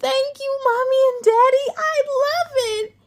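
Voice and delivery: high, fast, loud squeal of delight; a little girl thrilled with her gift; excited child. A narrator's reading. Aria thanking Mommy and Daddy for the bike, overjoyed.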